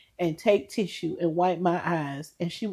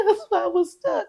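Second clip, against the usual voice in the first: laughing. Real, not acted. Laughs while explaining.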